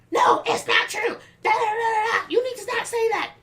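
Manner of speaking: imitating a loud, very high tantrum; mock fit. Worker imitates client throwing a fit, mock shouting. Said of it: "still throwing a fit".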